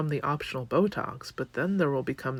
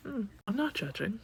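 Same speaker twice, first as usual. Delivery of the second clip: mock innocent; 7 semitones higher, 5 dB quieter than usual. Real, not acted.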